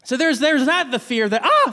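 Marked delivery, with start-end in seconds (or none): 1.4-1.7 quick high cry of fright; short acted startle; scared yelp. Shown as a demonstration. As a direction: demonstrate a jumpy "ah!". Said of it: "the scary fear".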